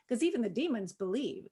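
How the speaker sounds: ordinary talk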